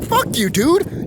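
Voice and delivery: high-pitched, drawn-out mock outrage; indignant retort. Actor told to pay for catering, indignant retort. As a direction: throw it back slowly, incredulous and high. Acting out offended Hollywood actor's reply.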